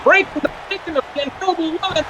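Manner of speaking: ringside play-by-play; wrestling commentator. Acted. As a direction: call the action like a wrestling broadcast.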